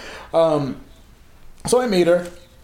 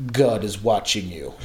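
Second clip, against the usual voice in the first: low mock-ominous guard voice; Senegalese guard's warning; 7 semitones lower than usual. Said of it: "he would lean over me and say".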